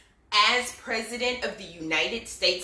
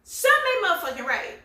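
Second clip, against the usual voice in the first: emphatic shout; 10 semitones higher, 5 dB louder, faster than usual. Real, not acted. Ranting about Biden's absence.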